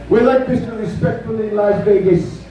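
ordinary delivery

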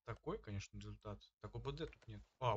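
ordinary talk, nothing marked

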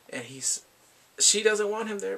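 usual speaking voice

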